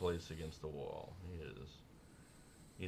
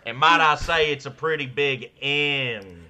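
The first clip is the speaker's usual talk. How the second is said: loud, raised hammed-up boast; mock country showman; 5 semitones higher, 11 dB louder than usual. Topping the duo partner's joke, loud showman boast. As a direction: draw it out like a stage braggart.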